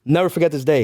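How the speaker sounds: ordinary delivery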